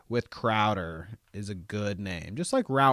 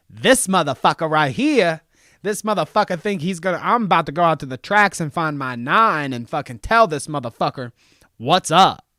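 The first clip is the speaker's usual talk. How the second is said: hammed-up angry rant, louder and higher; exaggerated mock threat, louder; mock furious rant; 4 semitones higher, 4 dB louder than usual. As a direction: puff up like a furious street tough. Mocking her furious rant.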